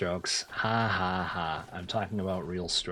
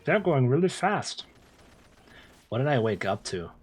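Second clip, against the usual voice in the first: ordinary talk, nothing marked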